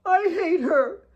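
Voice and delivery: put-on high, slow girlish voice, bitter; a hurt child spitting it out; sulky girl. Kate upset about Claire.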